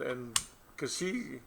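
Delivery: ordinary talk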